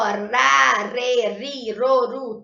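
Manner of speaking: "ra, re, ri, ro, ru" with a light tapped R, tongue flicking; soft tapped R. Shown as a demonstration. Teacher sounding out the soft R between vowels, light tap. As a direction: flick the tongue once on each of "ra, re, ri, ro, ru". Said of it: "É aquele R que fica vibrando".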